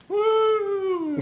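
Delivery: very high, thin whoop of victory; a playful, cartoonish victory woo; mock cheer. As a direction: squeal it like a goofy winner. Zombie girl cheers after winning.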